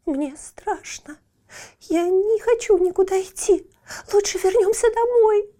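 put-on high, whining little girl; whimpering little girl. A narrator's reading. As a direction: whimper like a scared child. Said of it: "захныкала маленькая Метель".

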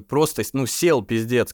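normal talking voice

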